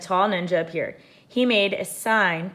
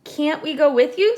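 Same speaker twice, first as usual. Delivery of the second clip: Annie pleading; 9 semitones higher, 7 dB louder than usual. A narrator's reading. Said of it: "said Annie".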